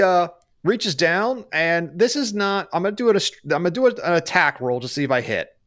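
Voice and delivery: ordinary delivery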